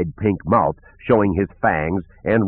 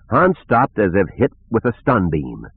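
plain reading voice

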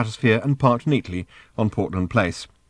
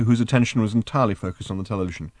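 ordinary delivery, nothing marked

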